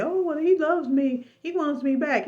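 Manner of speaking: high-pitched mock-hopeful voice; high, lilting mimicry of a lovestruck excuse; naive believer mimicked. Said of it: "And some people say".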